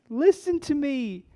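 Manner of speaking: a small daughter tugging at dad's sleeve; child demanding attention. Retold or imitated. Emma in the back seat demanding her daddy listen. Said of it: "and she says".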